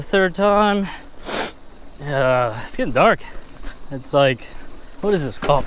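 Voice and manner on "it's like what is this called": normal talking voice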